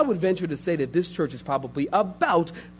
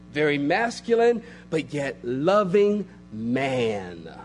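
ordinary talk

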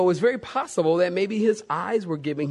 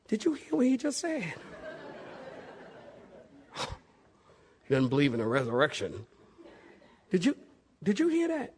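mock gossiping whisper; 2 semitones higher, 8 dB quieter, faster than usual. Paul stirring up the Pharisees, in a hushed gossipy aside. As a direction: lean in and stir the pot, softly. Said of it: "Paul's probably sitting there going".

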